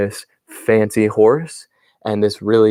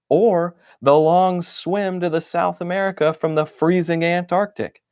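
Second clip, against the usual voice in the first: raised pitch, outraged and fussy; huffy, pitched-up grumbling; indignant diary voice; 6 semitones higher than usual. Acted. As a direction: complain like an offended toy. Indignant diary entry, voiced character.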